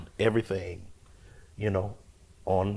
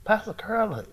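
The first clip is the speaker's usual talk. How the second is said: higher-pitched insistent call, acted out; calling for attention; 13 semitones higher than usual. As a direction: call out to someone ignoring you. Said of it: "he ignoring me".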